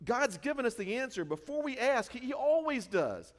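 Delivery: high, loud eager news; bright with sudden hope; excited. Retold or imitated. Betsy to Corrie, excitedly sharing the answer. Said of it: "said excitedly".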